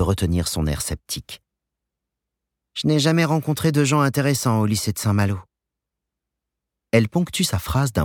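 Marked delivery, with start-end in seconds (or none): 2.7-5.4 put-on young woman voice. A narrator's reading. Joanne voiced skeptically.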